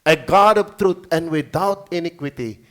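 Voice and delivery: slow, solemn verse reading. Acted. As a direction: read each word weightily.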